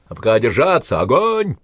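loud order rising to a high shout; commander's building battle cry; rallying command. Acted. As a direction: urge them to hold, then yell. Commander orders men to hold.

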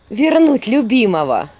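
loud, slow and defiant. A narrator's reading. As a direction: throw the answer back as a challenge. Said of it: "заметила с вызовом Василиса Чёрная".

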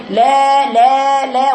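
each "لا" held long and steady; strong voiced madd. Shown as a demonstration. Teacher drilling the word's madd, long and strong. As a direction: repeat "لا" with a full, sustained ā. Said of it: "قوة، فيها صوت".

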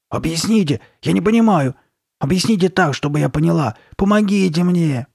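high, loud, urgent begging; desperate pleading. A narrator's reading. Girl begging the shopkeeper to explain, desperate plea.

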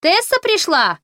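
high, loud call across the house; yelling from the kitchen. Acted. Fanny yells from the kitchen to the house. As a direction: bellow toward the next room. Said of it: "заорала с кухни Фанни".

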